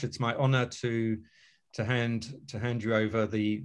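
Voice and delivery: ordinary delivery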